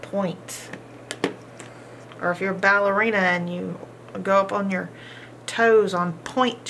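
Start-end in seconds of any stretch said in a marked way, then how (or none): 0.0-0.7 softly modeled word. Shown as a demonstration. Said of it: "Our next word is point".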